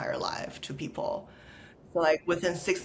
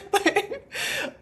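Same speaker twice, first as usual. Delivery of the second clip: a high laugh breaking out; high amused laugh at herself; high burst of laughter. Real, not acted. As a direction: laugh high, caught off guard. Laughing at her own story.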